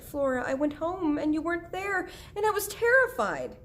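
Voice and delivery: high, worked-up voice of a frightened mother; distraught mother. Acted. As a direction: pour out a mother's fright, pitch climbing. Mother distraught over missing daughter.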